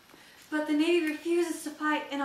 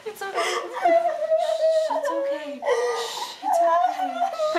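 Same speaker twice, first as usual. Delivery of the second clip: put-on soothing a child; 14 semitones higher, 6 dB louder, much slower than usual. Hushing a frightened child.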